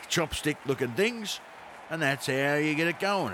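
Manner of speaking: broad Ocker Aussie commentator, rising with the race; Ocker Aussie accent. Acted. Mate doing rowing commentary for a comedy show, in a broad Ocker Aussie accent. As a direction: lay on the Ocker Aussie twang, building as they're off. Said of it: "an Ocker Aussie accent".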